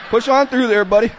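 a hammed-up coach pushing a sick client; mock tough coach. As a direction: cheer him on with fake toughness.

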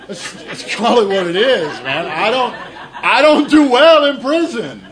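laughing. Real, not acted. Joking about not surviving prison.

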